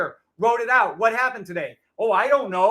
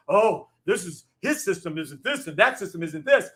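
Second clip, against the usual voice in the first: lightly voiced, lower mock of a critic; mocking TikTok critics; 4 semitones lower than usual. Streamer parodying TikTok critics, light mock voice. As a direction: parody the TikTok know-it-alls. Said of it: "Assholes going on and on about how".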